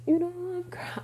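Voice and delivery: high, soft, cut-off start; flustered. Retold or imitated. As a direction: start flustered then break off. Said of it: "And I'm like".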